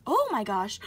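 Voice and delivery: loud burst of excitement; excited. Real, not acted. Finding a second seven on the ticket, loud excited burst. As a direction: blurt it out, thrilled. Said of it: "Sorry for getting so excited".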